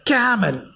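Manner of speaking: camel as CAM-el, first syllable stressed. Shown as a demonstration. Teacher showing students the correct word, camel stressed on CAM-. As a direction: hit CA in "camel", let -mel drop. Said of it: "C A must be stressed".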